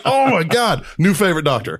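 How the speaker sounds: high, loud delighted exclaim. Retold or imitated. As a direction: gush with amused delight. Delighted reply in the story.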